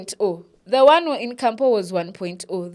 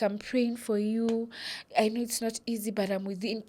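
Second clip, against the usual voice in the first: imitating sympathetic comforters; 2 semitones higher, 7 dB quieter than usual. People checking on grieving Brenda, softly sympathetic. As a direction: ease it out gently like a well-meaning visitor.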